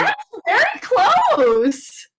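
very high, loud, stretched-out mock American accent; hammed-up, high-pitched American accent; attempted American accent. Shown as a demonstration. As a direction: wildly exaggerate an American accent, drawing it out. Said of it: "I can't do really do an American accent".

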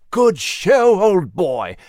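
a hint of a cheery British gent; lightly voiced jolly old chap; hearty Nigel. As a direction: cheer him on, chummy.

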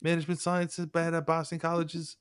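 high, hammed-up Boston townie; Boston accent. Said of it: "Say that in a Boston accent for me".

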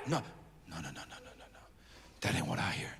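very low, soft dismissal; hushed denial. Acted. Prophet tells listener that isn't the sound, hushed. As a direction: wave it away in a near whisper.

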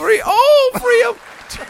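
very high, loud crying elf shout; Dobby impression. Cohost as Dobby crying out for his freedom, very high and loud. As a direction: wail it out like an overjoyed elf. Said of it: "as Dobby the house elf".

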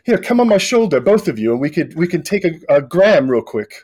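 put-on a high, hurried boy setting up a photo; eager boy voice. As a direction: rush them into a selfie. GM voices the boy taking selfie.

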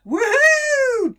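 high, loud whoop of joy; joyful whoop. Acted. Jack cheering as he splashes in, loud and high. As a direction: whoop as he leaps in.

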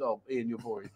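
low, soft, dismissive aside; mock dismissive viewer. Viewer voiced as bored of Ian, low and soft. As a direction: drop it flat and bored, a jab at Ian. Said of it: "And then he says".

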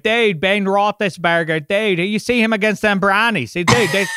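thick, garbled Pittsburgh yinzer talk; hammed-up Pittsburgh accent. Shown as a demonstration. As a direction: talk like a Pittsburgh sports fan. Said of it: "the Pittsburgh accent".

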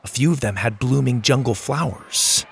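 plain reading voice

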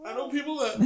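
high, very soft, choked-up start; real crying. Real, not acted. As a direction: try to speak through tears. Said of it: "I started crying like a little bitch".

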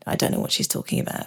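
hushed, soft private aside; inner thought. Retold or imitated. Client inwardly doubting the therapist, hushed inner voice. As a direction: whisper it as a secret thought. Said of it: "Inside gone".

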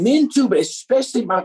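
ordinary delivery

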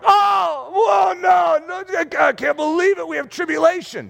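high, wailing dismay; hammed-up wail of disbelief; mock panic. Everyone else meeting tribulation, mock panic.

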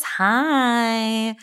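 normal talking voice

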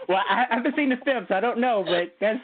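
high-pitched, talking through laughter; real amused laughter carrying into the words; laughing. Real, not acted. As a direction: answer while still laughing. Amused at host's mix-up.

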